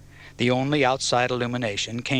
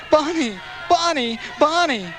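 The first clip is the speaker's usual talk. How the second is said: repeated very high cries of a name; screaming; 3 dB louder than usual. A narrator's reading. Man bursting through the crowd, screaming for a captive woman. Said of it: "burst through the crowd screaming".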